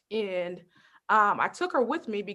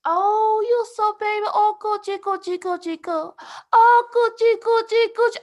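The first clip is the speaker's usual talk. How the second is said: put-on high cooing baby talk, much higher; 10 semitones higher, 5 dB louder than usual. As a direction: coo at a toddler in a high sing-song. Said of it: "She's like".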